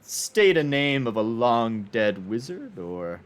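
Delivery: measured, probing noble stranger; wizard collector voice. Acted. Collector questions the adventurer about the dead wizard's name, lightly voiced. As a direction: question the player coolly, like an old collector. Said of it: "he goes".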